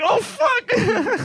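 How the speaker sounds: high-pitched squawk of alarm; mock shocked cry. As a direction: yelp it as if caught off guard. Reacting to someone climbing through window.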